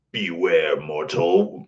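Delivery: low arcade villain warning; Sinistar. Acted. Streamer quoting favourite arcade game, low villain voice. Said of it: "was Sinistar".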